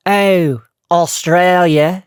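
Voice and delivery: slow, loud try at Australian; tentative Australian accent, drawn out; attempted Australian accent. Shown as a demonstration. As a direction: stretch it out in a rough Australian accent. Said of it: "Oh my God, this is tough".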